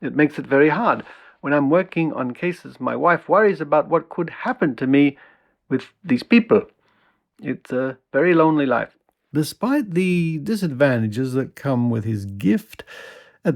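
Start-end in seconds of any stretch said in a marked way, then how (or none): 0.0-9.0 put-on Dutch accent, lightly raised pitch; imitation Dutch accent; heavy Dutch accent. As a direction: voice his quote with an approximated Dutch accent. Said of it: "in his heavy Dutch accent".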